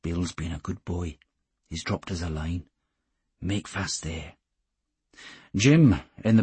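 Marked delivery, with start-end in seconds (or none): 0.0-4.3 barely above a whisper. A narrator's reading. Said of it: "than a whisper".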